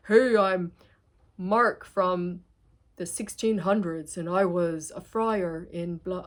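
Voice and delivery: lightly voiced old friar from the past; a hint of a spirit speaking through her; channeled friar voice. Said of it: "my voice is like this".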